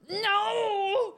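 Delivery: high, loud protest; shouted no. Real, not acted. Streamer shouting as beloved skin is threatened. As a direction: yell it to stop the loss.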